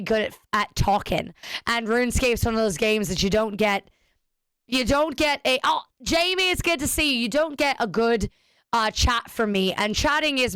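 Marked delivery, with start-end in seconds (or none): none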